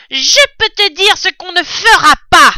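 beaucoup plus aigu et fort, martelé; cri rageur. Acted. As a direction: hurle sa détermination. Bin crie sa détermination. (Bin crie aux autres, plein de rage)

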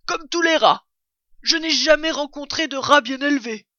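blaireau outré, voix plus haute et plus forte; indigne-toi, en montant la voix; indignation. A narrator's reading. Blaireau indigné par le rat. (blaireau scandalisé, ton haut perché)

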